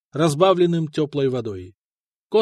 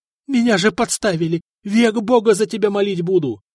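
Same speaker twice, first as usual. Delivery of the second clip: frantic pleading; 5 semitones higher, 4 dB louder than usual. Acted. Prisoner begging for rescue.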